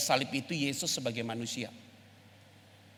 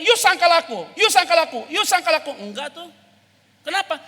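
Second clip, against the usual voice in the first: loud, high preaching at full force; fiery preaching; 10 dB louder than usual. Acted. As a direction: preach it hard to the congregation.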